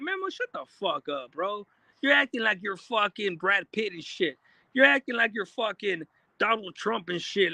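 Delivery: usual speaking voice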